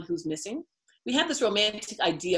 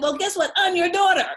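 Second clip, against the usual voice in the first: excited reveal; 12 semitones higher, 6 dB louder than usual. Retold or imitated. Telling her father who she is.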